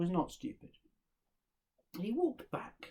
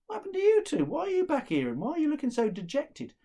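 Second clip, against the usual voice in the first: surprised questioning; 10 semitones higher, 5 dB louder than usual. Acted. Son surprised to find his brothers.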